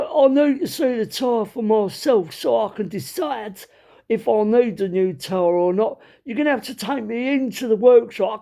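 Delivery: high-pitched East End Cockney rant. Retold or imitated. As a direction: kick right off like a proper Cockney. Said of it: "this guy was proper Cockney".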